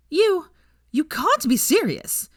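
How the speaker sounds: high, slow stunned disbelief; shocked. A narrator's reading. Millicent to Nott, high stunned protest. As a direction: stammer in shock.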